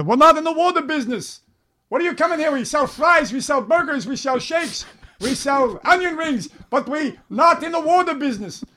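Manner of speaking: very high-pitched cartoonish boss rant; annoyed owner impression. Burger joint owner scolds kids wanting water, high annoyed voice. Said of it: "who used to get really annoyed".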